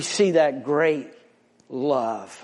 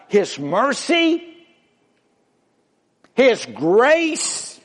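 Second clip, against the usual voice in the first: high, loud preaching call; rising preaching; 8 semitones higher, 4 dB louder than usual. Real, not acted. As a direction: lift the voice and call out to the congregation. Preaching at full force.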